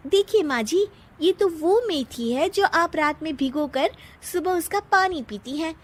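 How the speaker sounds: high-pitched, pointed explanation; daughter-in-law defending her cooking; daughter-in-law character. Acted. Daughter-in-law answers mother-in-law.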